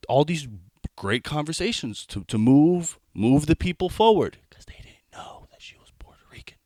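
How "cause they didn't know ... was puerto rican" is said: whispered secret. Real, not acted. Comic lets the crowd in on his mom's secret, whispering.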